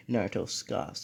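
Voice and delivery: usual speaking voice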